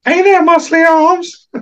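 slow, high, creepy come-on; creepy guy voice. Acted. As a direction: coo it like a creep sizing someone up.

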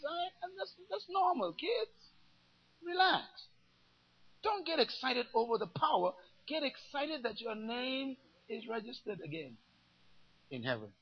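soft, soothing voice of Christ; calm the excited kids down; gentle reassurance. Retold or imitated. Voicing Christ calming disciples.

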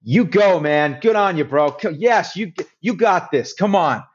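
put-on upbeat American accent, cheering a stranger on. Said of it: "your Amer-, American accent".

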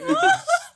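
loud high cackle; high burst of laughter. Real, not acted. Laughing at virgin joke.

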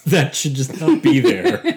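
real laugh breaking into the words. Real, not acted. Film podcaster on a character's weird control, laughing.

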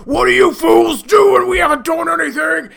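high, loud indignant shout; outraged orc shout. Acted. Orcs shouting accusations at the goblins, high and loud. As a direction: yell at the fools who turned on you. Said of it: "all you hear is".

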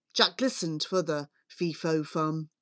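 usual speaking voice

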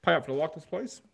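high, pleading Opera House Trust voice asking for money; a cheeky impression of a greedy trust asking nicely; mock pleading. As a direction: beg them sweetly to pay up. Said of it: "were going".

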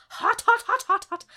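high, loud, laughing yelps; comic burnt-tongue outburst; panicked hot-sauce reaction. Acted. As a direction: yelp as if your mouth is burning. Acting out hot sauce burning.

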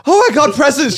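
high, loud delighted cry; excited kid. Retold or imitated. Child finding presents on the bed, high and loud with joy. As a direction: squeal it like an eight-year-old.

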